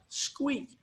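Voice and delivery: very high, soft mouse squeak. Acted. Little mouse answers the duck with a tiny squeak.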